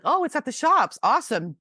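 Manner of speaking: high, thrilled kid reacting; eager and ready to dash off; excited. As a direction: re-enact childhood excitement, starting high. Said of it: "I'm all excited".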